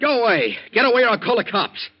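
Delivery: high, loud panicked pushing away; frightened shouting. Acted. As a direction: drive off a stranger in panic. Confused Danny fights off friend.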